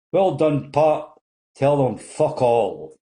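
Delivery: heckler calling out. Acted. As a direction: shout it from the back of the audience. Heckler shouts from the audience.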